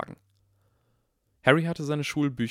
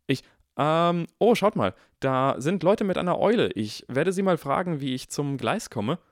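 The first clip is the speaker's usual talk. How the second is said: higher, louder nervous rush; flustered dodge; 7 semitones higher, 5 dB louder than usual. Acted. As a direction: wriggle out of it. Harry flustered deflecting. (nervös ausweichender Harry, lauter)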